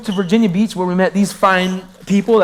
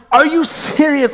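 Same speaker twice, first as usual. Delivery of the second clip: incredulous; 7 semitones higher, slower than usual. Retold or imitated. Complaining to God, incredulous.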